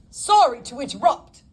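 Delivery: British gent politely cutting in. Acted. British lawyer politely interrupting, British accent. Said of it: "he said politely".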